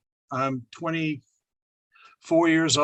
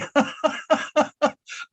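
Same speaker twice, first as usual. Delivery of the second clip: giddy high laugh; 8 semitones higher than usual. Retold or imitated. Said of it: "I'm laughing".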